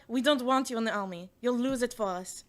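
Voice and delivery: imitated Israeli accent; shitty Israeli accent. Army officials rejecting her, in mock Israeli accent. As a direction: put on a rough Israeli accent. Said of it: "shitty Israeli accent".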